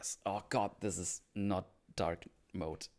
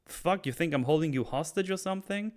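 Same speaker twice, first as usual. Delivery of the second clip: acted outrage, pitched up; angry challenge; 9 semitones higher, 4 dB louder than usual. Oz asks Vic if he's a hostage, angry. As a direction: throw the accusation back at him, heated. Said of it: "I like how angry Oz is here".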